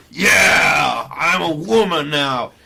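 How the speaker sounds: very loud butch parody; mock-serious shout. Mimicking butch people in serious selfies, loud mock shout. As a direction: bellow it as an over-serious declaration. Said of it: "with them looking really serious".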